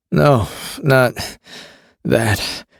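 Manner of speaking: breathless, halting words; gasping between words; out of breath. A narrator's reading. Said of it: "had to take a breath between words".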